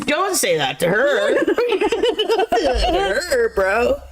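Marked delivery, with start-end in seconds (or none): 1.4-4.0 high, laughing plea; cracking up. Real, not acted. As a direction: squeal the words through laughter. Repeats the line while cracking up.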